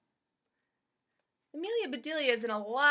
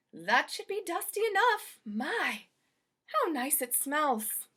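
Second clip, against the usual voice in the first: higher, pleased maid, slowing; Amelia Bedelia; 4 semitones higher, 3 dB louder, slower than usual. Acted. Maid admiring the dusty room, pleased. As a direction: admire the work happily.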